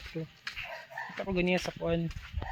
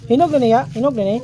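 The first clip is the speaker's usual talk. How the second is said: loud question; 6 semitones higher, 14 dB louder, faster than usual. Real, not acted. Excited asking if ripe.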